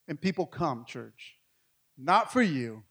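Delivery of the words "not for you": ordinary talk, nothing marked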